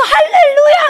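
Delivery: very high, loud hallelujah through laughter and tears; a loud, laughing, tearful hallelujah; shouted hallelujah. Retold or imitated. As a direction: burst out in joyful weeping. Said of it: "하면서 막 손을 막".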